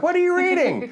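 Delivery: mock an excitable elderly churchgoer, way up high; overjoyed old lady voice, pitched far up; gushing old church lady.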